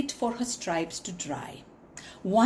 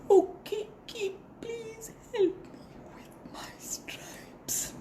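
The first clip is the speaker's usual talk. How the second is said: high, whispered begging; whispered plea; 11 semitones higher, 8 dB quieter than usual. Acted. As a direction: whisper it to stay quiet. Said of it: "Keep quiet".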